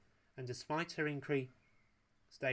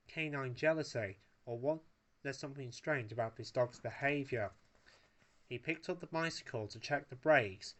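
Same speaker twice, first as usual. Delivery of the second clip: ordinary delivery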